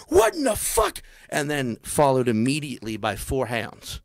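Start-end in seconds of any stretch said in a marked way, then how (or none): 0.1-1.0 high startled cry; mock shocked yelp. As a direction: squeak out in disbelief. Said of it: "everybody's just like".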